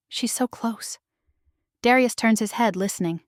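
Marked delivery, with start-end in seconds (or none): 0.1-1.0 much softer, almost whispered; quiet awed murmur. A narrator's reading. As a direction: hush it in tearful hope. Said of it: "I whisper".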